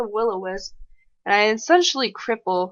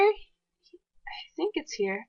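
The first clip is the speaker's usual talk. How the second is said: hushed whisper, slow; suspense whisper; 7 semitones higher, 4 dB quieter, much slower than usual. Real, not acted. Gamer teasing the next move to viewers, hushed whisper. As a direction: breathe it out secretly. Building suspense before a play.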